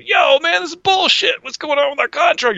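high, loud, indignant complaint; band member voice. Retold or imitated. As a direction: complain like a fed-up musician. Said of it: "speaking lines".

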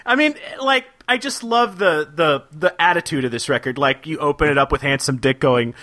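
ordinary delivery

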